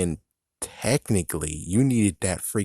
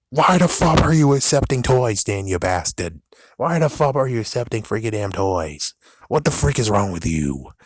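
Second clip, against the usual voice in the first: angry rant; 5 dB louder than usual. Real, not acted. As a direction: yell it at her, fed up. Ranting at the shop owner.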